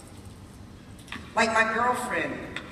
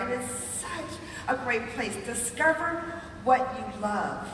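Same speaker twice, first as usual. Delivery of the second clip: normal talking voice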